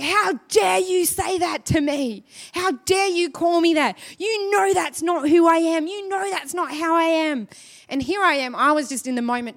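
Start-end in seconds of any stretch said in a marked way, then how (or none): 0.0-7.5 higher, offended, a hint of hurt anger; mock outraged retort. Husband blowing up at his wife in the car, mildly hammed outrage. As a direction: play the husband's indignant blow-up, lightly. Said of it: "he just blew up".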